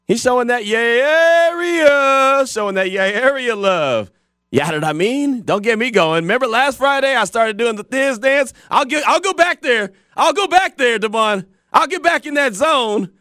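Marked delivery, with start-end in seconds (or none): 0.1-4.1 hyped up. Real, not acted. Hyped about the Bay. 5.4-13.1 hyped up. Real, not acted. Hyped about [name].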